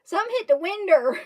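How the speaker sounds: put-on country-boy "winder" with an r ending; retold country pronunciation. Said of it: "I heard him say".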